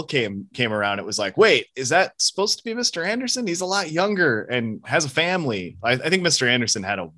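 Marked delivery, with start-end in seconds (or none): 1.4-5.8 lightly acted surprised realization, pitched up; high-pitched questioning, mildly incredulous; mock puzzlement. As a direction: play a viewer doing a double take. Acts out puzzled viewer reaction.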